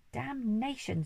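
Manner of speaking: high, forceful damnation; the Duke shouting in frustration; angry shout. A narrator's reading. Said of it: "shouted the Duke".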